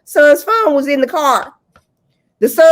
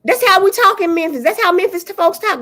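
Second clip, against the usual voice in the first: higher, mimicking TikTok girl; imitated TikTok girl; 7 semitones higher than usual. Mimicking a girl who claims to speak for Memphis, higher mock voice. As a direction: put on her voice, pitched up. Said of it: "and she like".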